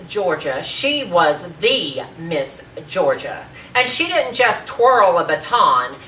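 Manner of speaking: loud, emphatic speech building to full force; proud, forceful oratory with punched words; indignant tirade. Acted. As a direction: defend your sister in a grand, ringing tirade. Acted tirade defending her sister to Marjorie.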